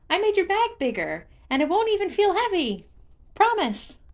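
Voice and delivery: a chirpy little creature showing off; singsong Hestu voice. A narrator's reading. Hestu speaks, voiced character.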